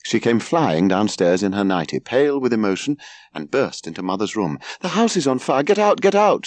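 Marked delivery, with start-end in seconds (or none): 4.8-6.5 high-pitched alarmed yell; dramatic yell. A narrator's reading. Daughter bursting into Mother's room about a fire, yelling dramatically. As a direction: raise the alarm, high and urgent. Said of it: "she yelled dramatically".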